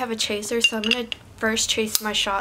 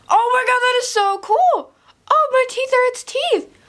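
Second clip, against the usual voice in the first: very high, loud excited squeal; excited shriek; 8 dB louder than usual. Real, not acted. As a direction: squeal with sheer delight. Amazed by face filter.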